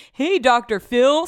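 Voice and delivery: high, loud, slow sing-song hello; milk the TV-host joke; cheesy joke greeting. Pretend. Greeting the doctor named Phil, slow cheesy sing-song. The joke she held back.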